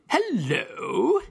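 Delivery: mock English hello, pitched high; high, drawn-out English-accented hello. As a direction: imitate an English greeting, swooping up. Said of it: "if you were in England".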